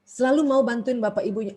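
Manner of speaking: ordinary talk, nothing marked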